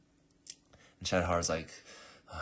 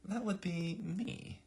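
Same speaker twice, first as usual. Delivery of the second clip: smug reveal; 14 semitones higher, slower than usual. Acted. Clerk reveals she's next monarch.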